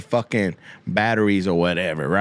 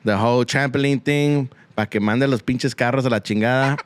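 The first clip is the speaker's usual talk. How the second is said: ordinary talk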